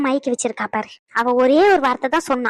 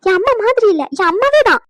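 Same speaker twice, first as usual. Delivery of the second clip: higher, louder hammed-up gushing; over-acted devotion; 7 semitones higher, 5 dB louder than usual. Wife overacting love for her mother-in-law, hammed-up and higher. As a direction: ham up the sweet daughter-in-law act. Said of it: "எப்படி நம்ம acting?".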